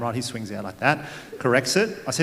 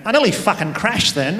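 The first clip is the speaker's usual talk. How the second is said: higher, louder shaken outburst through laughter; shaken mum; 6 semitones higher, 5 dB louder than usual. Retold or imitated. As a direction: mum blurting her near miss, half laughing. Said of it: "looked at me and went".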